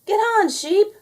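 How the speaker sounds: high, loud shouted call; group shout. A narrator's reading. Everyone shouting at Sheep, high loud call. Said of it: "everyone shouted".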